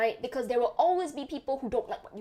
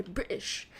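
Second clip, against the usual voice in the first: mock British accent; 4 semitones lower, 5 dB quieter than usual. Repeats British with glottal T.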